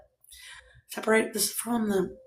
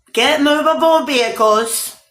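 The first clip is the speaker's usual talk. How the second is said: higher, louder and slow, like a rally cry; proclaimed slogan; 8 semitones higher, 7 dB louder than usual. Shown as a demonstration. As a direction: announce "movable" to the crowd, slowly. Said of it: "We're get movable vehicles".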